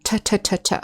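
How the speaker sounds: crisp aspirated "T, t, t" bursts; unvoiced T sound. Shown as a demonstration. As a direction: tap out "t" hard with a puff of air. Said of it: "the unvoiced T sound".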